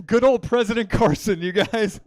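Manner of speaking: loud, high sarcastic announcement; mocking cheer. Real, not acted. Comic teasing audience over a bad answer, mocking cheer. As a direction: hail it loudly with mock pride.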